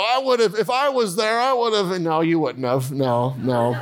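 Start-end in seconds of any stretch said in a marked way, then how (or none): 0.0-1.9 much higher know-it-all bragging; armchair quarterback boast. Retold or imitated. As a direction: brag like you'd have done better from the couch. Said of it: "you're like".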